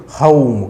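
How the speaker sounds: हाउम gliding up from low, au then m; हाउम with a rising au glide; haum demo. Shown as a demonstration. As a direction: model हाउम slowly for the class. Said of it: "हाउम sound जो है नीचे से up level पर जाती है".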